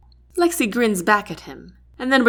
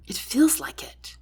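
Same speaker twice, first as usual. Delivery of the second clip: breathy near-whisper; soft whisper; 4 semitones higher, 3 dB quieter than usual. A narrator's reading. Sarah answering Lexi, soft whisper. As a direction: say it softly, almost to yourself. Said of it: "I say softly".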